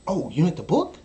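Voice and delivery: high, loud feigned realization; teasing fake surprise; mock surprise. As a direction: play dumb to land the joke. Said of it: "and then I go".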